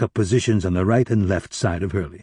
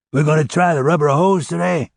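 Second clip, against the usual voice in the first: sarcastic prisoner voice; 6 semitones higher than usual. Acted. Hurley voiced by the narrator.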